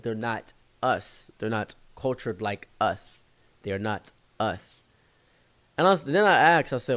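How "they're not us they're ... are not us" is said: slow imitated African accent. African friend explaining who is not us, slow African accent. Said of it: "He said".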